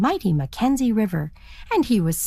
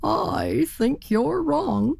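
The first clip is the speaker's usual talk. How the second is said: slow, drawn-out, higher stubborn reply; a lightly voiced obstinate turkey; 7 semitones higher, much slower than usual. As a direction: insist slowly but uneasily. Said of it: "said the turkey".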